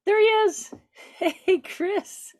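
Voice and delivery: high, loud, excited welcome with laughter; gasp, then greet them high and giddy; delighted greeting. Real, not acted. Host spots the awaited guest joining the call, excited.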